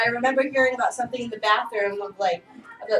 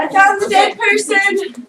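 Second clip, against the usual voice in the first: calling out; 9 semitones higher, 10 dB louder, slower than usual. Acted. Game announcement of a body.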